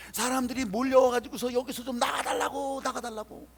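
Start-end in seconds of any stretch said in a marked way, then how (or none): 1.5-3.5 crowd's acted plea. Townspeople asking Jesus to leave, acted insistent plea. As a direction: plead as the crowd that has rushed over.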